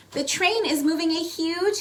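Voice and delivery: usual speaking voice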